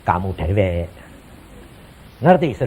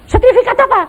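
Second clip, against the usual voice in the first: surprised question; 5 dB louder, faster than usual. Retold or imitated. Retold dialogue with God.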